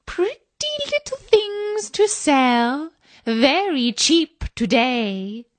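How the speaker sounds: put-on high, slow old peddler call; high sing-song hawker voice; peddler crying wares.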